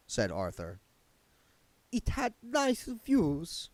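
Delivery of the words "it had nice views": high, slow, affected countess voice; a lofty vampire countess, drawn out; Doreen's voice. A narrator's reading. Doreen's character voice.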